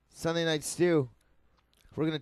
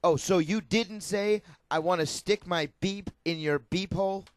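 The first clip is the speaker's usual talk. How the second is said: Chris Hansen. Acted. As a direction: confront him like a sting-show host. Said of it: "read them in his Chris Hansen-y voice".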